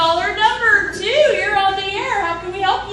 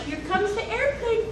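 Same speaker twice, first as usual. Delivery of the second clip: airplane feeding game; 5 semitones higher than usual. Acted. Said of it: "pretend it's a game".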